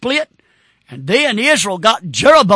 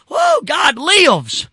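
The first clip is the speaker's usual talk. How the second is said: excited cry; 6 semitones higher, 3 dB louder, much slower than usual. Real, not acted. Preacher overcome mid-sermon.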